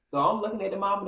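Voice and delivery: ordinary delivery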